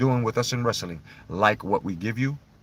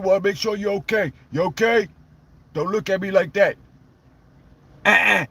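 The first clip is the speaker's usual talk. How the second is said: higher put-on Stone Cold voice; Stone Cold impression; 8 semitones higher than usual. As Stone Cold telling the fan story, a raised put-on voice. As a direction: tell it like Stone Cold himself.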